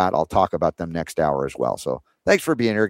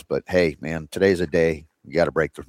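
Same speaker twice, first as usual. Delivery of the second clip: ordinary talk, nothing marked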